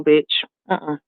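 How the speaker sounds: ordinary delivery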